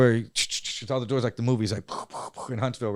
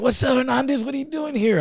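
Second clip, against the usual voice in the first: much higher teasing greeting; surprised inmate greeting; 12 semitones higher than usual. Retold or imitated. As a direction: greet him like a surprised buddy.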